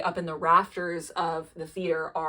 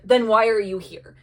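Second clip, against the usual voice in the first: raised, louder snap back; angry; 3 semitones higher, 9 dB louder than usual. Retold or imitated. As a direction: demand it angrily. Acted quarrel, Victor to Caliban.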